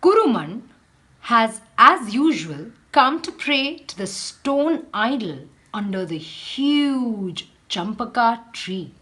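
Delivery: measured read-aloud for a class; teacher reading a picture book aloud; storybook reading. Acted. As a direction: tell the tale to children, unhurried.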